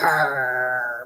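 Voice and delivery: low, drawn-out growl; imitated dog growl. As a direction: growl like a grumpy dog, retelling it. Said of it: "she's like".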